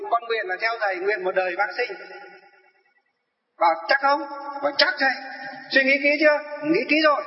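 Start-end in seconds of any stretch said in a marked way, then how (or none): 3.6-4.2 mock stern monk. Monk questions the elderly follower. 4.8-7.2 voicing the eager elder. Dialogue voiced by the monk.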